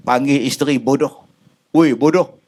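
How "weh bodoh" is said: abrupt call-out insult; mock rude husband. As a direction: snap at the wife. Husband calling wife stupid. (suami memanggil isteri bodoh dengan kasar)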